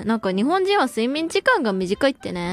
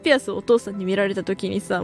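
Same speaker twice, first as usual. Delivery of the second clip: ordinary talk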